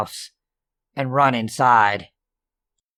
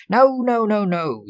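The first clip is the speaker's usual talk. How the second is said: frantic no; 9 semitones higher, 3 dB louder than usual. Acted. Panicked refusal to brother.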